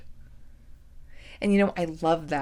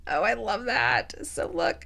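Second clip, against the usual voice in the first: ordinary talk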